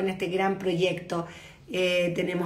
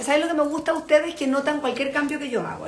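ordinary delivery

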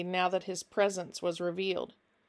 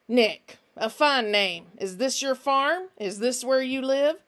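louder, smooth questioning; fake-friendly Finch; 4 semitones higher, 5 dB louder than usual. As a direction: repeat the name and pry, high and insistent. Finch coaxes Nick.

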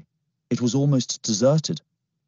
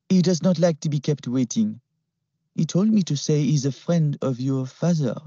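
put-on French-accented threat; 7 semitones higher than usual. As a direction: threaten him calmly.

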